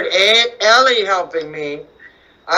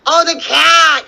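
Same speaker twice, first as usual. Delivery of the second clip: usual speaking voice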